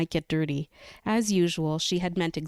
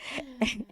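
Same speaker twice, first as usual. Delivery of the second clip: embarrassed laugh; 6 semitones higher, 5 dB quieter than usual. Real, not acted. Laughs at own stumble.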